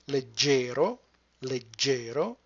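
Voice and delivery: leg-GE-ro, middle syllable long and stressed; stress on second syllable. Shown as a demonstration. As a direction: lean on the GE of "leggero", rising at the end. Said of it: "put the stress on the second syllable".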